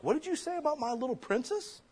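high, indignant mock mom voice; offended mom. Offended mom snaps back at the teacher, high and indignant. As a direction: pitch it way up like a huffy mother.

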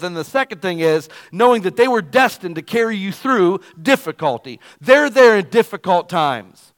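loud, rising pulpit preaching; fiery preacher building the point; preaching at full force. Acted. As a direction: drive every line to the back pew. Opens the sermon at full force.

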